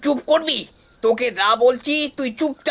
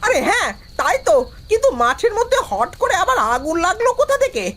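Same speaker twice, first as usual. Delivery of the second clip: high, swooping astonishment; startled surprise; 8 semitones higher than usual. Acted. Villager spotting fire in the field, startled surprise. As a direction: react with alarmed amazement, pitch leaping up.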